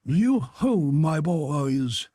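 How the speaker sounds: put-on a rich, fat, jovial old master calling out; jovial Fezziwig voice. A narrator's reading. Old Fezziwig calls to his apprentices, jovial and loud. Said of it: "said Fezziwig".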